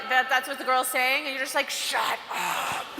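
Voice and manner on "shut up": low demonic growl of shut up; exorcist voice. Retold or imitated. Fed-up girl silencing a worrier, in a low exorcist voice. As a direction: drop into a possessed growl. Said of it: "your exorcist voice is going really hard".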